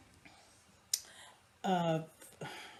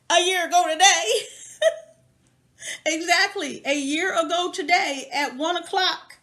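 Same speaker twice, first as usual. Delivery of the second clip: laughing excitedly; 8 semitones higher, 5 dB louder than usual. Real, not acted. Excited about dissertation anniversary.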